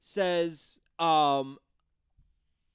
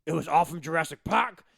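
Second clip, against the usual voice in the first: mock preacher; 2 semitones higher, 6 dB louder than usual. Imitating Carl Everett interview.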